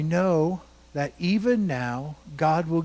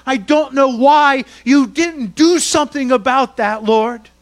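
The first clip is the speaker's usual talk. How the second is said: desperate pleading cry; 9 semitones higher, 11 dB louder than usual. Acted. Martha's plea voiced at full force.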